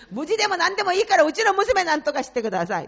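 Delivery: 高く大きく速く、必死に叫ぶ; desperate crying plea. Retold or imitated. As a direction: blurt it out fast, begging for your daughter. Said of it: "泣きながら". (娘のために泣きながら必死に叫ぶ)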